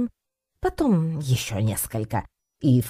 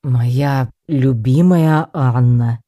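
loud, very slow declamation; solemn second attempt; 8 dB louder, much slower than usual. A narrator's reading. Professor retrying his proposal to Anna, solemnly. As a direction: proclaim it pompously, stretching every word. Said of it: "начал он торжественно".

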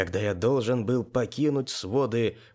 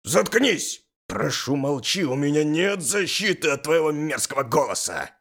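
higher, strained shout to shut up; sudden yelling; 4 semitones higher, 3 dB louder than usual. A narrator's reading. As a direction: burst out yelling, pleading for silence. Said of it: "а потом неожиданно закричал". (неожиданно закричав)

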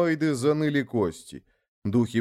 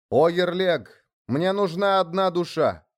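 loud reply; 7 semitones higher than usual. A narrator's reading. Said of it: "громко ответил".

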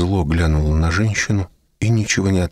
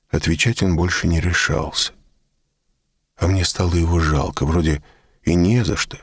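ordinary delivery, nothing marked

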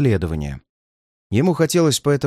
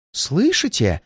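first alarmed question, raised pitch, held back; hushed-in alarm, higher voice; frightened moan; 6 semitones higher, slower than usual. A narrator's reading. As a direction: ask fearfully without raising the volume. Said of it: "простонал он".

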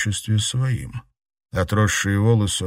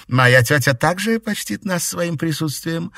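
sweetly questioning witch; 10 semitones higher than usual. A narrator's reading. Witch asks about her aunt.

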